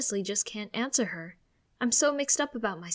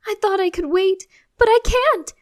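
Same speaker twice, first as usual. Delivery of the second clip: much higher and louder, unable to hold it in; bursting excitement; 12 semitones higher, 14 dB louder than usual. Acted. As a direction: squeal it out, can't wait any longer. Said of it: "she beams with excitement".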